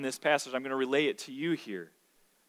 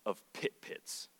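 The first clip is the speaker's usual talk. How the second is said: whispered pit pits, slow; hushed, half-amused whisper of "pit pits"; 8 semitones lower, 14 dB quieter than usual. Shown as a demonstration. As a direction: breathe out "of pit pits" with no voice, slowly. Reads the literal translation aloud.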